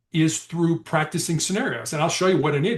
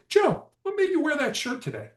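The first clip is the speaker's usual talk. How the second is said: softer question; 12 semitones higher than usual. Shown as a demonstration. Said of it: "That's a softer question".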